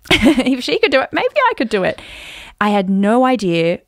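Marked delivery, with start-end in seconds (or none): 0.1-2.0 high, louder, laugh-led burst of hope; giddy excitement. Acted. Woman reliving her hope of matching her idol, giddy. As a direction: squeal it like a thrilling realisation.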